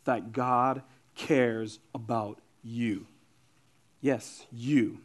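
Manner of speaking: low, soft and slow, with pauses; tender assurance. Real, not acted. Preacher assures someone who never believed it, softly and slowly. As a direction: bring it down and say it personally.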